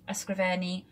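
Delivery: "Ysgrifennu" said carefully in Welsh; Welsh word. Shown as a demonstration. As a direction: sound out "Ysgrifennu" syllable by syllable. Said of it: "that's an interesting word".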